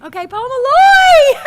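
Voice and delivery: slow, high, loud announcement; excited call out. Real, not acted. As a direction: call the name out gleefully. Announcing next speaker excitedly.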